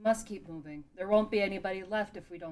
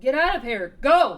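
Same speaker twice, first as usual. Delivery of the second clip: urgent shout; 5 semitones higher, 19 dB louder than usual. Acted. Evacuating Gorons from collapsing mountain.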